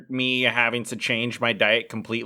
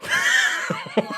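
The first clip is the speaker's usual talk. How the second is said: high, shrieking burst of helpless laughter. Real, not acted. Lifting weights after the trainer's outburst, a high squealing laugh. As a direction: crack up, barely holding it in. Laughing mid bench press.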